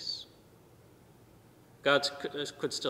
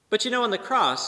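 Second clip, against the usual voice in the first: much higher and louder burst; sudden emphatic lift; 10 semitones higher, 9 dB louder than usual. Real, not acted. Preacher rousing the congregation, higher and louder. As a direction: break out to grab attention. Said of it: "sudden lift in sermon".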